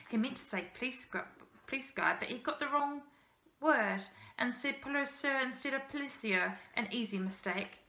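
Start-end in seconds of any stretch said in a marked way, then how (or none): none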